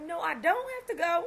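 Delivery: high, loud defiant refusal; indignant retort. Retold or imitated. Dancer refusing to leave, loud indignant. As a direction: refuse him, fired up. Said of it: "I said".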